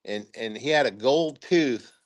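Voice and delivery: ordinary talk, nothing marked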